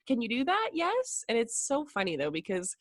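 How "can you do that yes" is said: questioning yes. Shown as a demonstration.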